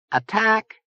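high-pitched, tense question. A narrator's reading. Gomi Boy questioning Zona's plan, tense. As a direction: voice jumps up with tension. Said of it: "his voice betrayed his tension".

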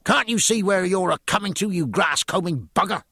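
high-pitched, indignant outburst; irritated sailor's complaint; angry call. A narrator's reading. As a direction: call out crossly at whoever tripped over you. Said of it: "called out angrily".

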